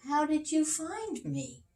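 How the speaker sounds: high, curious elephant; Jumbo's voice. Acted. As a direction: ask it gently as a surprised elephant. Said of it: "asked Jumbo".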